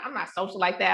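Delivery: normal talking voice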